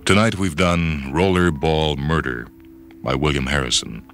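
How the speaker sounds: ordinary delivery, nothing marked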